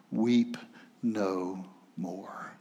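hushed and soft, falling away to near silence; soft quiet reassurance; tender comfort. Acted. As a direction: lay it down gently like consoling someone in tears. Elder comforting weeping John.

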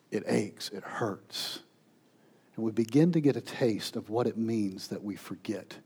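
soft, low and weighty; hushed, solemn. Real, not acted. Preacher tells congregation about loved ones forgetting, hushed and solemn. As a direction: let the voice sink gently as the pain lands.

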